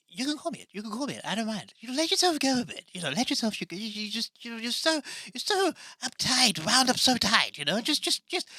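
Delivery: high, loud comic character, egging him on; high character voice, stammering with exasperation; high-voiced sidekick, goading. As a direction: needle the straight man in a high put-on voice. Character urges him to loosen up.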